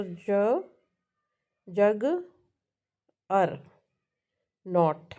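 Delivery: long pauses between the pieces "जग", "अर", "नॉट"; syllable by syllable. Shown as a demonstration. Teacher counting syllables in a word, broken apart with pauses. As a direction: sound out each syllable on its own. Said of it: "three syllable word है".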